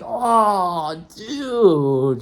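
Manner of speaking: dismayed oh dude. Retold or imitated. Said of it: "I'm like".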